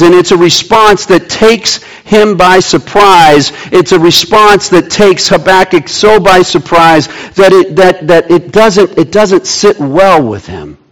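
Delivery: usual speaking voice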